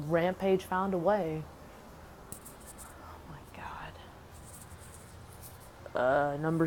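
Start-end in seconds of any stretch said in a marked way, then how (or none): 3.0-3.9 exasperated whisper; whispered aside. Real, not acted. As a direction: breathe it out in disbelief. Exasperated aside after the rant.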